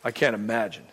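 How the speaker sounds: low, soft retold condolence; subdued apology. Preacher retells consoling a new widow, low and subdued.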